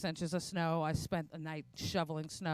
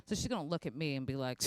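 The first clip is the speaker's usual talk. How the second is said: normal talking voice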